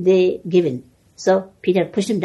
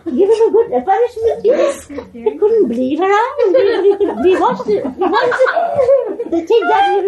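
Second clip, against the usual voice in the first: laughing; 7 dB louder than usual. Real, not acted. Laughing while telling funny scene.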